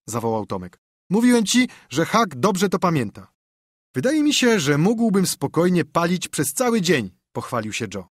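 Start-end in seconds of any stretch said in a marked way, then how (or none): 1.1-7.1 put-on higher, eager boyish voice; boasting boy. Tom and Joe brag to Huck, boyish and eager. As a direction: brag like a kid.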